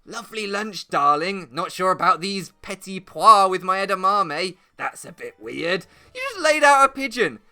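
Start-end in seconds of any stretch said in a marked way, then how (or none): 0.0-5.9 mock-nonchalant lunchtime chat; genteel diner. Pigeon puncher to his darling over lunch, mock-genteel nonchalance. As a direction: put on a leisurely, genteel dining voice. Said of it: "You can't be like".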